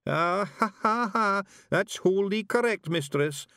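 high, loud Scottish pictsie chuckling; a daft Feegle laughing at his own joke; proud Feegle laugh. Acted.

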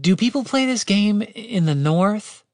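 high, slow, pointed question; suspicious. A narrator's reading. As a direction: ask it slowly with wary doubt. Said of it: "Catherine asked suspiciously".

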